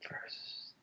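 unvoiced whisper; whispered aside. Real, not acted. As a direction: breathe it as a quick unsure aside.

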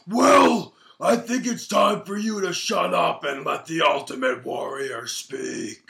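loud, slow wrestler promo growl; Ultimate Warrior. Retold or imitated. Retelling the Warrior's mask promo, slow and gruff. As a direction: boom it out like a masked wrestler. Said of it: "he put on the mask and he goes".

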